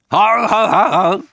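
put-on slow, jolly old man chuckle; Santa ho ho laugh.

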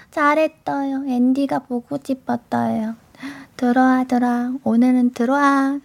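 hushed doting aegyo to the listeners; soft aegyo. Acted. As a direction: coo 들어와, 들어와 sweetly like a pet name. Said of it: "애교 잘하면 종합예술인 되는 거예요?".

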